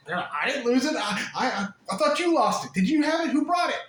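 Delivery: a flustered angel insisting it wasn't him; defensive little angel. Acted. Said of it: "Unhappy little angels ... freaking out, going".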